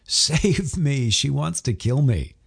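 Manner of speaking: a grown man hamming up a cry for rescue; Connor pleading. A narrator's reading. Husband hamming up a plea to his friends, mock-panicked.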